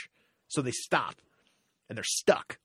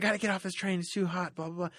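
higher, whiny voice of a woman losing it; imitating the frantic woman; 7 semitones higher, faster than usual. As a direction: play the panicked woman on the subway, pitched up. Retelling the deranged woman's words.

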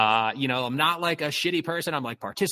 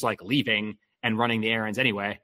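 ordinary delivery

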